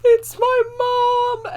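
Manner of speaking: very high, loud, drawn-out wail; exaggerated comic sobbing; mock crying. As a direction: bawl it out in hammed-up tears. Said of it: "I'm just gonna start crying".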